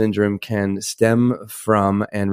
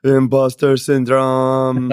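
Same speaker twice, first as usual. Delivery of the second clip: shaky falsetto, slow and higher; nervous voice; 4 semitones higher, 4 dB louder, much slower than usual. Real, not acted. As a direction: draw out imposter nervously. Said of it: "that's my nervous voice right there".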